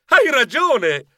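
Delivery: esclamazione convinta e acuta; esclamando. Acted. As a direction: dai ragione con slancio, alzando la voce di tono. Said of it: "Io esclamai". (esclamazione entusiasta)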